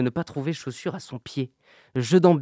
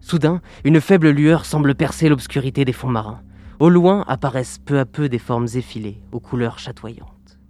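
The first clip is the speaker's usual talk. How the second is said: epic fantasy narrator; 2 semitones lower than usual. Acted. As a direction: draw the listener down into the dark. Immersive deep-sea scene narration.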